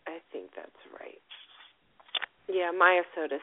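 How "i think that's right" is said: whispered aside. Real, not acted. Aside while spelling.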